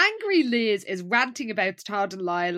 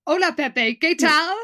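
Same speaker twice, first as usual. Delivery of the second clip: raised, louder Spanish greeting, lightly voiced; Spanish tape voice; 6 semitones higher, 7 dB louder than usual. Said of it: "we hear".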